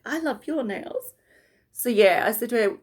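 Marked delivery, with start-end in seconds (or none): 0.1-1.1 delighted reply. Retold or imitated. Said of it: "And she's like".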